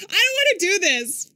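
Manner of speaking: high, loud wail of reluctance; dreading it out loud; loud reluctant protest. Real, not acted. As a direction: protest loudly, pitch jumping up. Dreading continuing the game.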